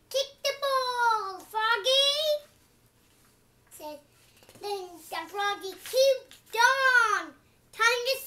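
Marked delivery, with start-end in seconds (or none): 0.1-2.5 calling out. Acted. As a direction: coach calling across the field. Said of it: "And then he said".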